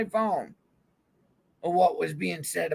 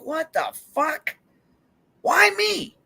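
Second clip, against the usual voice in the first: high, slow, disbelieving cry of why me; incredulous outburst; 9 semitones higher, much slower than usual. Retold or imitated. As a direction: act out your own stunned reaction, rising to a yelp. I'm like.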